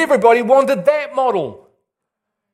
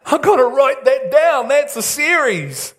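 inner voice delighted; 4 semitones higher than usual. Retold or imitated. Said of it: "I'm hearing it going".

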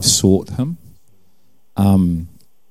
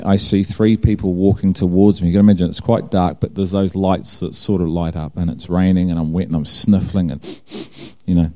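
ordinary delivery

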